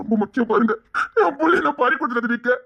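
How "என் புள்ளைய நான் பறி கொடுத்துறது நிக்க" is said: bitter tearful laugh, pitched up; laughing through tears. Acted. Father scoffing at comfort while crying, bitter tearful laugh. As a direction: laugh and sob at once.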